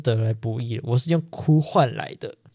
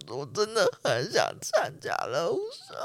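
soft, slow, tearful reenactment of begging, higher; the student tells seniors tearfully she wants to join the dance club, soft and higher; retold crying plea; 5 semitones higher, 6 dB quieter than usual. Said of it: "我就哭着说".

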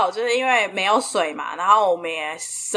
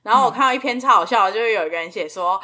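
ordinary delivery

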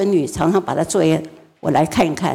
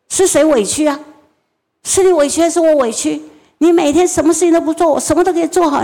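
higher, louder nonstop tirade; furious scolding mother; 8 semitones higher, 3 dB louder than usual. Retold or imitated. Mother berates her crying son, high and loud. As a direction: let the mother's fury pour out at the child.

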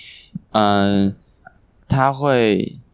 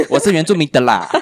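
louder, higher laughing mock line; laughing mock claim; mock declaration; 6 semitones higher, 5 dB louder than usual. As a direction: jokingly announce it. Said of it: "我要说".

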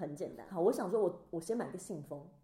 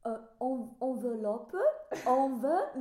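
slow, halting attempt at French; imitating a tongue-tied learner's French accent; bad French accent; 5 semitones higher, 4 dB louder, much slower than usual. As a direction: stumble through clumsy French, higher than usual. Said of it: "他们听不懂我的腔调".

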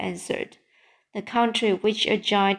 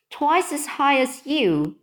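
higher-pitched, lightly amazed exclamation; mild astonished cry; 6 semitones higher than usual. A narrator's reading. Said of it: "cried Sophie".

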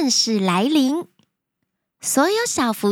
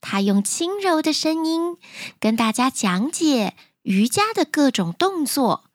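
ordinary delivery, nothing marked

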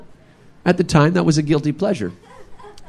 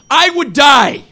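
high, loud mock indignation; indignant brother; 6 dB louder, slower than usual. Brother protests his allergy, high indignant cry. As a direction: protest like it's life or death.

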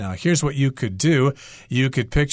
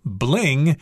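ordinary talk, nothing marked

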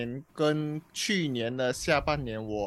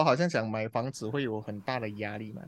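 ordinary delivery

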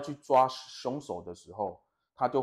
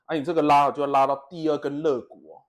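louder, bossy trainer voice; strict coach; 2 semitones higher, 8 dB louder than usual. Retold or imitated. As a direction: bark out precise orders like a gym coach. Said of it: "这么凶".

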